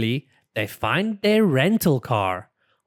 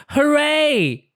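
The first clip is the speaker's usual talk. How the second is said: high, loud hooray; high excited child cheer; 14 semitones higher, 4 dB louder than usual. Acted. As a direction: cheer with delight. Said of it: "cries Peppa".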